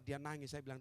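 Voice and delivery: ordinary talk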